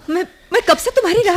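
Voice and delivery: high, excited outburst; too loud. Acted. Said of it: "धीरे बोलो श्रीमती".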